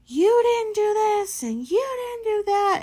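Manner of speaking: high, loud, shrill-free mock scolding of a boss; mocking angry supervisor. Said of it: "points her finger at me and starts like".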